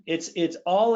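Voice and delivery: ordinary talk, nothing marked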